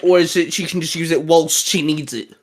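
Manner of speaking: normal talking voice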